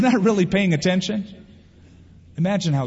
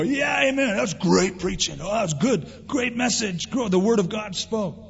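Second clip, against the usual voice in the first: higher, gushing mock praise; over-sweet after-service compliments; gushing churchgoer; 6 semitones higher than usual. Churchgoer praising the sermon, hammed-up gushing. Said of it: "You can go".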